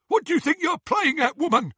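high, loud angry challenge; angry snatcher. Acted. Scabior confronting Bellatrix, angry high challenge. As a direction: bark at her like a thug.

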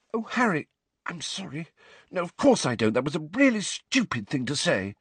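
high, dismayed, hurried apology; horror-struck apology. A narrator's reading. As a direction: take it back in sudden dismay. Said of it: "looked suddenly horror-struck".

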